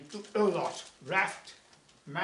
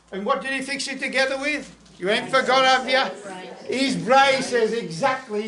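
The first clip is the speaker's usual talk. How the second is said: teasing quiz; 6 semitones higher, 9 dB louder than usual. Real, not acted. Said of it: "quizzing the listeners on braces".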